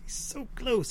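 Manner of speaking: breathy mock of the desperate coder's line. Mocking the movie's coder about his nearly finished AI, soft high voice. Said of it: "he says".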